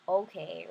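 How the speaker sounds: mild reluctant big brother sigh. Acted. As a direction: sigh then a grudging okay. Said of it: "he was like".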